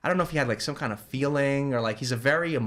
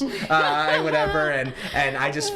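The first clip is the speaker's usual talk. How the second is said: laughing; 4 semitones higher, 4 dB louder, slower than usual. Real, not acted. Laughs retelling landlord's brush-off.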